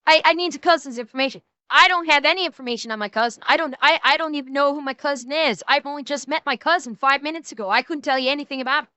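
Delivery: fast mock Southern accent. Streamer in a mock Southern accent, mimicking the suspect. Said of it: "do you like Majestic with the southern accent".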